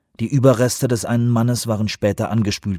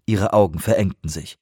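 usual speaking voice

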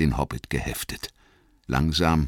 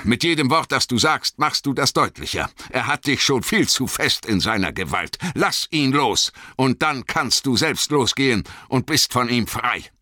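raised, urgent wizard's warning; Gandalf, scharfer Ton; 10 semitones higher, 4 dB louder than usual. A narrator's reading. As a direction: press him sternly, voice higher. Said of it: "Er schlug einen scharfen Ton an". (scharf und eindringlich)

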